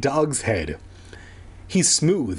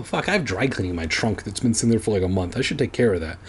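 usual speaking voice

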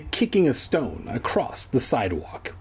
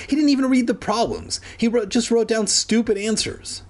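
much higher, fast and indignant; outraged rant; 9 semitones higher than usual. A narrator's reading. Andy ranting about Kermit's wrong answers, high and indignant.

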